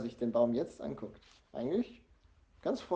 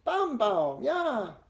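put-on high toddler cheer, loud; happy little child crowing; gleeful shout; 10 semitones higher, 4 dB louder, much slower than usual. A narrator's reading. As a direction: squeal it out in delight. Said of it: "schreit sie vergnügt".

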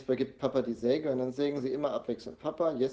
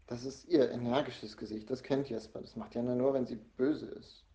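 ordinary delivery, nothing marked

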